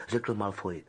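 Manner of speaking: usual speaking voice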